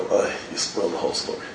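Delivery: unvoiced, soft inner thought; hushed aside to himself; whispered thought. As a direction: breathe it like a private thought.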